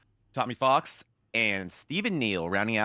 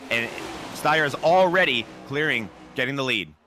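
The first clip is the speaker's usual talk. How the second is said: louder, rising race call as the green flag waves; live play-by-play; 5 semitones higher, 4 dB louder than usual. Acted. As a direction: call the start with building energy.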